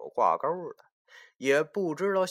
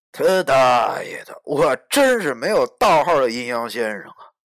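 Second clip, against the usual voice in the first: desperate outburst; 8 dB louder than usual. Acted. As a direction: vent the despair out loud.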